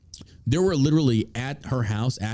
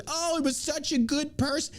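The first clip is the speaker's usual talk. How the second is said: much higher, mocking sing-song voice; 4 dB quieter than usual. Mocking the leftist line about the shot man, high and sarcastic. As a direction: sneer at the sentiment in a put-on high voice. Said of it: "the stereotypical".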